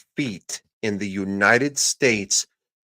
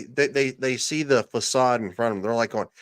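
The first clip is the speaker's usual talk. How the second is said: ordinary delivery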